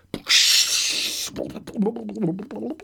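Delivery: high gleeful goblin cackle. Acted. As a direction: cackle with triumph as the trick pays off. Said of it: "cried the goblin".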